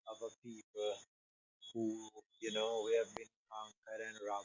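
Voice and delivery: sinking out of earshot mid-thought; too quiet. Real, not acted. Panelist fading too low for the host to hear, soft. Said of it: "Your voice is a little bit low".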